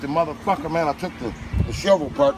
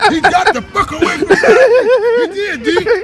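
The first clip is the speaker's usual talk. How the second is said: loud, high laughing telling; 10 dB louder than usual. Real, not acted. Telling friends how the man ran, laughing loudly. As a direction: crack up while telling it.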